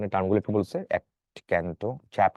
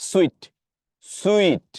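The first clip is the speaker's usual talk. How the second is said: Sweet lifted sharply high and louder; high pitch; 9 semitones higher, 5 dB louder, much slower than usual. Shown as a demonstration. Teacher demonstrating the odd word to the class, high pitch. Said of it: "একটু high pitch".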